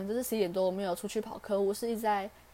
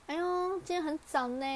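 much higher, teasing "哎呦" stretched out sarcastically; sarcastic dad; 9 semitones higher than usual. Retold or imitated. Dad needling daughter for coming home early, mock sarcastic higher voice. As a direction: imitate dad's sour jab, pitched well up. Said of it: "很酸哦，超酸的哦".

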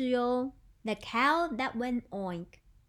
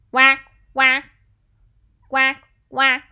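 loud, short quacks; duck quacking; 14 dB louder than usual. Shown as a demonstration. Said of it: "it's a duck".

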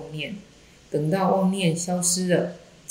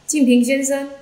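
sudden raised shout to hail someone; loud call; 8 semitones higher, 5 dB louder than usual. A narrator's reading. Zen master hailing a layman in the street, shouting. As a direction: call the name out high and loud. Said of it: "仙崖禅师就大喊".

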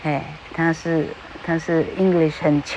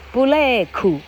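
布雷库 as three syllables, bu-re-ku; 布雷库 with a Japanese accent; Japanese-style English; 5 semitones higher, slower than usual. Shown as a demonstration. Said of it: "大概是这样哈".

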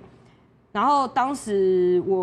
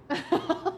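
high laughing burst; 10 semitones higher than usual. Real, not acted. Laughs at the threat.

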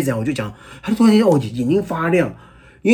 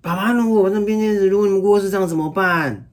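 put-on raised child's worried question; 7 semitones higher than usual. Father voicing his son's fear, high and childlike. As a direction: ask like an anxious little boy.

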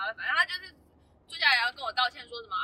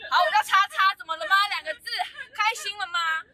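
indignant retort; 6 semitones higher, 6 dB louder than usual. Retold or imitated. Retorting to the dean in story.

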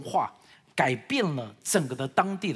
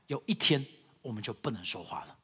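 ordinary talk

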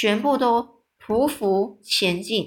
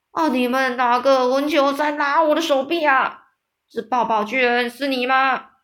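loud, higher-pitched bellow of a storybook giant; giant's roar; 4 semitones higher, 3 dB louder than usual. A narrator's reading. Giant demanding who is pulling its arm, roaring. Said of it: "他大声咆哮着说".

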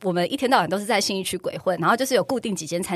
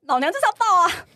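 defiant outburst; 9 semitones higher, 4 dB louder than usual. Retold or imitated. Defiantly justifying her outburst.

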